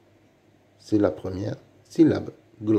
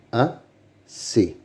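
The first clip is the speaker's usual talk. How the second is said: "Assez" as a, pause, ssez; syllable by syllable. Shown as a demonstration. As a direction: sound out "Assez" in two separate beats. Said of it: "Lis les mots". (« Assez » découpé syllabe par syllabe)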